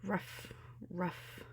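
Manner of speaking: soft, breathy growling barks; monster bark. A narrator's reading. Dream monster barking at Ariel and Flounder, breathy growl. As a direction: bark like a lurking beast. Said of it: "cried the monster".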